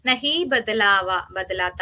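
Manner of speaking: drawn-out, deliberate meaning; slow definition. Shown as a demonstration. Teacher defining a term to the class, slowly spelled out. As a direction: lay each word down slowly for students. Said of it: "അവികാരി ശബ്ദം എന്ന് പറഞ്ഞാ".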